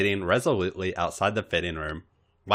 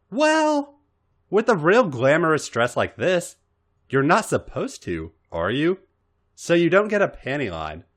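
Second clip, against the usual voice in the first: naive Robin voice; 6 semitones higher than usual. Acted.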